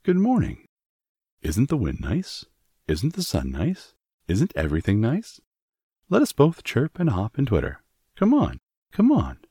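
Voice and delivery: lilting bird voice, leaping high at times; chirpy robin. A narrator's reading. Robin greeting Mary from the wall, chirpy and inviting. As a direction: chirp it out like a cheery little bird. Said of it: "It was as if he said".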